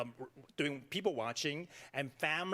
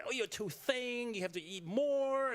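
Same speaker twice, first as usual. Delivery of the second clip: higher, slow fussing mother voice; nagging mother; 9 semitones higher than usual. Retold or imitated. Mother pushing food on her thin child, mock fussing. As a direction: fuss over a child who won't eat. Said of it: "force eating me".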